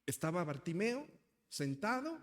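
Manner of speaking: ordinary talk, nothing marked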